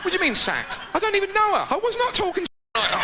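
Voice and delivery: hammed-up outraged innocence; mock panicked protest. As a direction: squeal the defence like a cornered man. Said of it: "imitated defensive protest".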